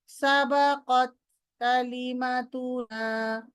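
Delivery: slow সাবাকত কালিমাতুনা with clean unaspirated ত; careful pronunciation. Shown as a demonstration. Teacher modelling correct ত for students, slow. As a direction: enunciate সাবাকত then কালিমাতুনা slowly. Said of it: "না, না".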